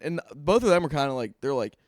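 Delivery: usual speaking voice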